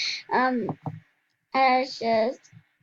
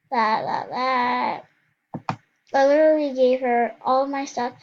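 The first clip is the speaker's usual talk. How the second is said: normal talking voice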